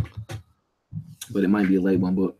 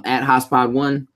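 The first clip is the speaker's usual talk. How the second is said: ordinary talk, nothing marked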